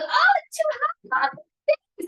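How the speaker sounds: high mock child complaining about bathwater; imitating a young child. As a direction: play the fussy five-year-old. Five-year-old daughter voiced at bath.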